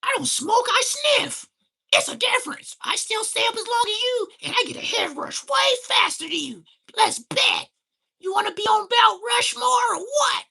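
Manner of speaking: shrill, high, loud mock voice of a sniffer; high squeaky mocking brag; mocking impression. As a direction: play the smug sniffer in a squeaky shout. Acting out the other smoker.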